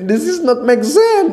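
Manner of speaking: high, slow exasperated protest; skeptical adult. Acted. Adult rejecting the Santa story, exasperated and slow. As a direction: throw up your hands. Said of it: "Orang dewasa".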